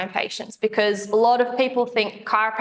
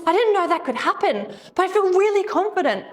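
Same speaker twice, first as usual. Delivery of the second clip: imitating patient; 9 semitones higher than usual. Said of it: "He goes".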